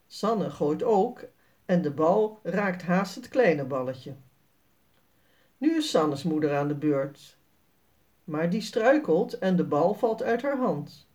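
plain reading voice

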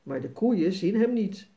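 ordinary delivery

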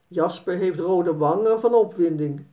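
ordinary delivery, nothing marked

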